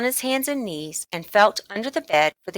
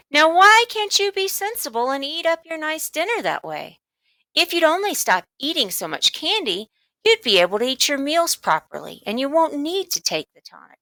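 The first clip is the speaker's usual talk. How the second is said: mother's voice; 9 semitones higher than usual. Acted. Mother lecturing about candy.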